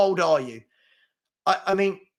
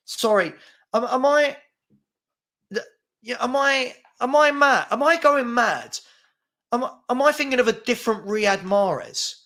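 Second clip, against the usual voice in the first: incredulous disbelief; 7 semitones higher than usual. Real, not acted. Streamer baffled by a chat comment, rising incredulous. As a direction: pitch climbing higher and higher in exasperation. Disbelief at chat's Mahrez take.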